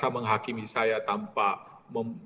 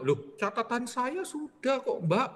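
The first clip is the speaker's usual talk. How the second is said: much higher, startled objection; indignant protest; 11 semitones higher than usual. Retold or imitated. Frater protests to the maid, high and indignant. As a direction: protest that your notes say it's paid.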